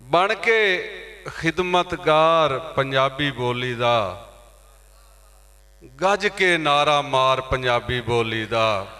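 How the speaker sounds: measured declaiming with falling line ends; poetry recitation. Acted. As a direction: proclaim verse in praise of the mother tongue. Recites poem on Punjabi language. (ਮਾਂ ਬੋਲੀ ਦੀ ਕਵਿਤਾ ਦਾ ਪਾਠ)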